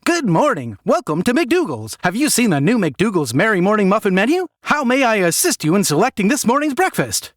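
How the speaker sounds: fast-food cashier greeting a customer, forced cheer; chirpy cashier. Acted. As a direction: sell the muffin menu like a trained robot. Drive-through cashier's scripted greeting.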